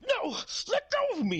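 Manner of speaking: strained, very high struggling clown; Ronald McDonald. Retold or imitated. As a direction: squeal it like a clown in a headlock. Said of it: "And then he's like".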